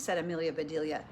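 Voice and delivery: ordinary delivery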